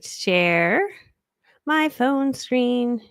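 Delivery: singsong drawl while hunting for a button; drawn-out searching. Real, not acted. Coach narrating her screen sharing as she searches, drawn-out. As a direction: stretch each word very slowly, rising.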